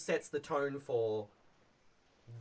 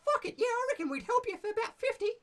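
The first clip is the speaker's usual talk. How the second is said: much higher and louder, a cheeky goblin sentry; brash goblin challenging strangers at the bridge; 5 dB louder, faster than usual. Shown as a demonstration. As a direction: squeak it out like a rude little goblin guard. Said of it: "And so he's like".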